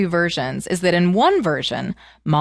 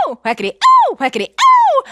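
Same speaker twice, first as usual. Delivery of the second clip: high, loud ows; pained yelps; 8 dB louder than usual. Acted. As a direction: yelp with each whack. Wife hit in the sack.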